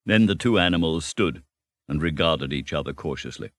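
ordinary delivery, nothing marked

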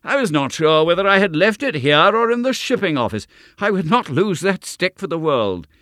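overjoyed to find the lost stick; Mortimer's delighted cry; joyful exclamation. Acted. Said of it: "with an exclamation of joy".

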